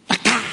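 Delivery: high sharp knock imitation; striking sound. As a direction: mimic the staff hitting rock. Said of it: "홧김에 막".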